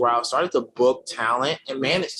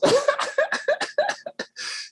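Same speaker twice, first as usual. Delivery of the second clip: airy high laugh. Real, not acted. Laughs at own joke.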